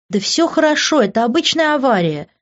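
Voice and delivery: high-pitched, exasperated brush-off; exasperated reassurance. A narrator's reading. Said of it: "закатила глаза Лив". (раздражённо успокаивая, высоким голосом)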